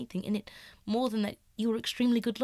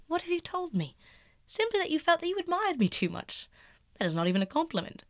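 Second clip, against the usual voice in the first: much higher, lightly mocking youth; mocking Dorian; 10 semitones higher than usual. Dorian teasing Basil, much higher and lightly mocking. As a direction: toss it off, teasing.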